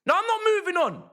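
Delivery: high, heated yell; indignant refusal. Real, not acted. Fan won't let the disallowed goal go, indignant yelling. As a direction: refuse point-blank in outrage.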